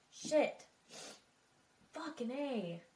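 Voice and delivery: ordinary talk, nothing marked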